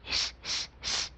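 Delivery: whispered hush hush; shushing. A narrator's reading. Papa shushing the cricket so baby sleeps, whispered. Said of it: "بابا قوله بهش گفت".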